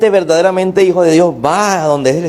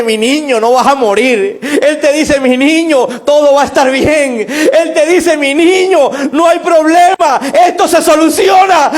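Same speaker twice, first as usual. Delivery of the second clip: rising to a shouted climax; preaching build; 9 semitones higher, 3 dB louder than usual. Acted. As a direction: build from fervent to a full shout, voice cracking. Preacher voices God comforting child.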